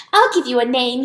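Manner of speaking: ordinary delivery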